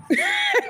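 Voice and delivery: sudden high burst of laughter. Real, not acted. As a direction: laugh out at how absurd it is. Laughs at the absurdity.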